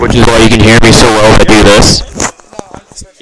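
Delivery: blown-out mic, right against it; loud distorted mic clipping. Real, not acted.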